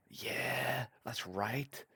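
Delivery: ordinary talk, nothing marked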